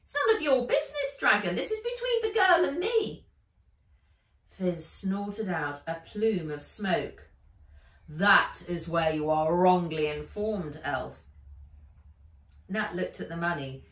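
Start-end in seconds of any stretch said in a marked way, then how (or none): 0.0-3.2 high, fast, loud elf voice; glaring elf. Acted. Elf glaring at Fizz, high and fast. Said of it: "glared at him". 8.1-11.3 loud, firm dragon voice; a dragon laying down the law. Acted. Fizz insisting the elf keep her deal, loud and firm.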